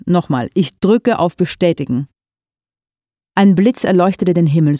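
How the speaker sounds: plain reading voice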